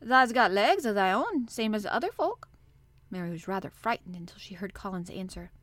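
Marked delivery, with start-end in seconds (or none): 0.0-2.5 stout and firm. Acted. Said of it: "he said stoutly".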